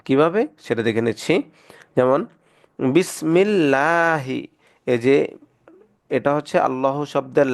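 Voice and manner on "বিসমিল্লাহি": বিসমিল্লাহি slowly with a thin, light lam; tajweed light lam; light lam recitation. Shown as a demonstration. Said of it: "এটাকে চিকন করে পড়ব".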